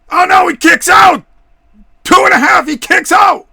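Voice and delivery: builds to a high shouting call; wrestling commentator. Acted. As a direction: ringside announcer going wild at the kickout. Play-by-play, building to shouted kickout.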